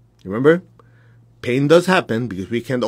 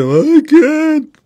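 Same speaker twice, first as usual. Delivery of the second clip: much higher, louder, slow mock whine; whiny helpless excuse; 8 dB louder than usual. Teacher mocking the excuse he rejects, high whine. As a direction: wail the excuse like a helpless child. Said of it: "So when people say".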